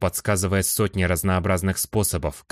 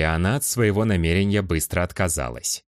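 ordinary delivery

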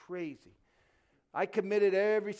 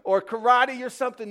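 loud, high mocking tone; much louder, higher sarcastic voice; mock gung-ho; 6 semitones higher, 12 dB louder than usual. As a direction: ham up the silly army plan. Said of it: "It wasn't like".

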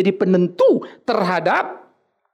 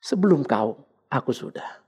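low, hushed divine reply; voice of God; 4 semitones lower, 6 dB quieter than usual. Acted. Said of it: "kau akan dengar dia bicara".

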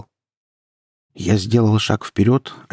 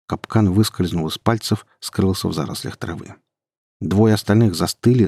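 ordinary delivery, nothing marked

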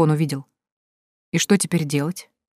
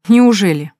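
louder, skeptical cry; skeptical exclamation; 3 semitones higher, 6 dB louder, slower than usual. A narrator's reading. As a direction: throw doubt at him. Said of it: "скептически воскликнула она". (скептически воскликнуть, громче)

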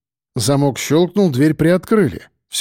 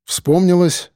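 usual speaking voice

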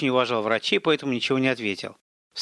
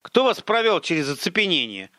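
barking; 6 semitones higher than usual. A narrator's reading. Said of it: "рявкнул Сумков".

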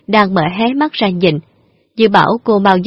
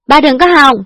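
defiant shout; 7 semitones higher, 4 dB louder than usual. Acted. Daughter defies father, fleeing.

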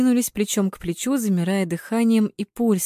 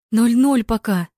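raised, emphatic announcement; announcing; 4 semitones higher, 6 dB louder, slower than usual. A narrator's reading. Anya reporting the score to her friend, announcing. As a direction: call out the score loudly. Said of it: "огласила Аня".

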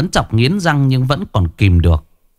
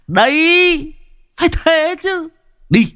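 much higher, gleeful thug voice; pleased thug; slower than usual. Acted. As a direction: crow it like a delighted street punk. Hào pleased with Huy.

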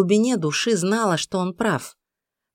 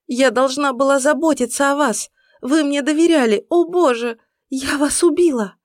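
громко, высоко, в отчаянии; кричи в панике и горе; истерика; 9 semitones higher, 4 dB louder than usual. A narrator's reading. Иден в истерике над телом. (истерический крик)

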